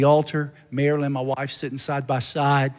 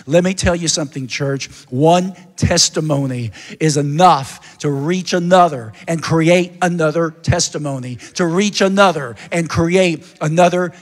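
louder, higher, rising preacher's appeal; preaching at full force; 3 semitones higher, 8 dB louder than usual. Acted. Pastor urging his church to share testimony, full-force preaching. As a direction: build it up and preach to the congregation.

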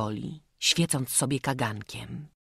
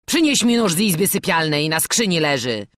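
loud, brusque command; raised-voice master; giving orders; 3 semitones higher, 8 dB louder than usual. A narrator's reading. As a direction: order the servant boy about. Robber orders the boy.

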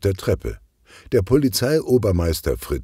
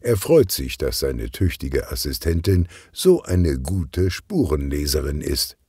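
plain reading voice

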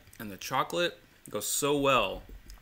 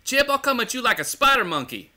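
high, loud movie-character yell; shouted movie quote; 12 semitones higher, 6 dB louder than usual. Retold or imitated. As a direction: bellow the threat high and loud.

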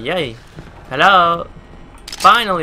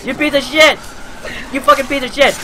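high, loud angry yelling; real furious screaming; furious shout; 11 semitones higher, 4 dB louder than usual. Real, not acted. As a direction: scream abuse at the liar. Yelling at lying voice.

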